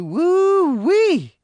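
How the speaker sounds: high whooping woo-wee; gleeful holler. Acted. John whooping over Maureen on the phone.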